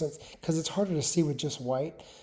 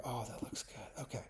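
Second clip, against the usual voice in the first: whispered to himself; 4 semitones lower, 18 dB quieter than usual. Real, not acted. Admiring his own design choice.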